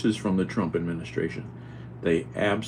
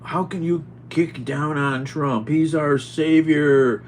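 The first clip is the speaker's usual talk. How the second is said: slow, higher mocking imitation; mocking the friend; 8 semitones higher, 4 dB louder, slower than usual. Imitating the Trump-supporting friend who blocked him, mocking and slow. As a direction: mimic the preachy friend. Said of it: "And he's like".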